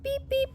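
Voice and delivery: horn beep. A narrator's reading. Truck horn in story.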